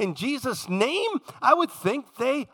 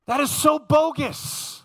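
scornful rejection; 6 semitones higher, slower than usual. Real, not acted. Preacher dismissing a false teacher, slow and scornful. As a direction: call it bogus, slowly and with contempt.

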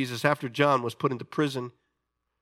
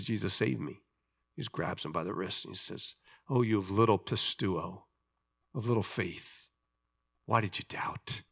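soft rebuke; 8 semitones lower, 8 dB quieter than usual. Acted. Rescue at sea, tender rebuke.

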